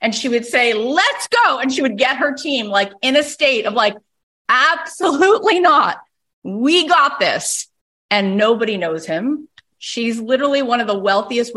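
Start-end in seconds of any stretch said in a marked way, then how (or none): 0.7-1.6 much higher, pumped-up call to action; rallying cry. Retold or imitated. Barbara firing up her team in a rough market, pumped. 4.5-7.7 much higher, pumped-up call to action; rallying cry. Retold or imitated. Barbara firing up her team in a rough market, pumped.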